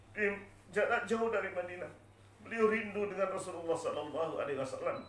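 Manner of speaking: ordinary delivery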